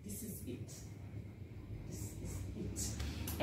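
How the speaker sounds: barely voiced, slow whisper; whispered resignation. Facing death, a slow whispered this is it. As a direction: whisper it like the end has come.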